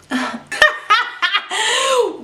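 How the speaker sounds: real high laugh bursting out; a high, delighted cackle; high cackling laugh. Real, not acted. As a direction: cackle at her own shade.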